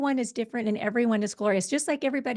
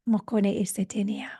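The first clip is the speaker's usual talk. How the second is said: whisper. Real, not acted. Pod leader whispering a sacred phrase to her group. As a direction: breathe the words out. Soft phrase in light language.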